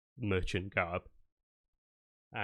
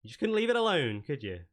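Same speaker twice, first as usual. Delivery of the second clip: high, quick sneering taunt, then dropping off; mock her target as if she's already won; smug villain taunt; 5 semitones higher, 3 dB louder, faster than usual. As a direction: Verleen Firecrow taunting the party, smug and fast.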